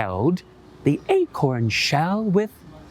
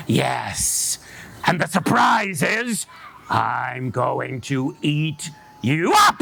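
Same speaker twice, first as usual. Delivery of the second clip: menacing fox; slower than usual. Acted. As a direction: growl the threat like a hungry fox. Fox threatens the rabbit.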